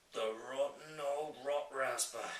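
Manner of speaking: giant's drawn-out, indignant exclamation; indignant cry. A narrator's reading. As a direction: cry out slowly in disgust at a villain. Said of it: "cried the B F G".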